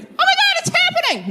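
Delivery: very high excited squeal; giddy squeal. Pretend. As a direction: squeal as a toy being picked up. Stuffed animal in claw machine.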